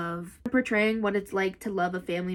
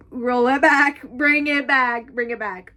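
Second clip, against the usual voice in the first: loud, high, drawn-out call; playful hype call; 4 semitones higher, 8 dB louder, slower than usual. Pretend. Reader reviving her World War Two era, playfully loud. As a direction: call it out big, stretching the words.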